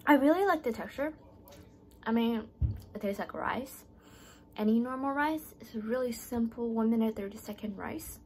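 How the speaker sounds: chewing between words; talking around food; mouth partly full. Real, not acted. Seen 0: Chews before; pursed, moving lips while talking, rice held up.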